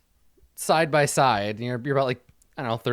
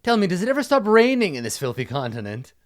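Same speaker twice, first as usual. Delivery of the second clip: higher-pitched pompous knight voice; complaining knight; 5 semitones higher, 6 dB louder than usual. Acted. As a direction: grumble theatrically to the heavens. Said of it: "looks up and he says".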